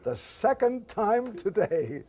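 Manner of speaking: high, loud and slow, fed-up groan of a line; exasperated mock complaint. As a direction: drag out the weary complaint. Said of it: "he said".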